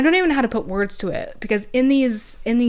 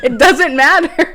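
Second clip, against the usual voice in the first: high, loud, slow laughing line; 6 semitones higher, 10 dB louder than usual. Retold or imitated. Laughing about ceremonies.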